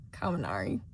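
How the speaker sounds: ordinary delivery, nothing marked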